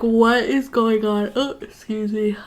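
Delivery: a real yawn stretching the words; words swallowed by a yawn; yawning while talking. Real, not acted. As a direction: talk slowly through a yawn. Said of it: "I'm yawning".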